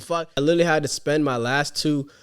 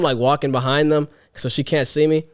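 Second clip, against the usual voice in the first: ordinary talk, nothing marked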